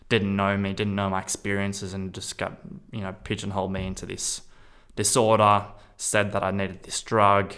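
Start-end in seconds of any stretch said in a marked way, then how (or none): none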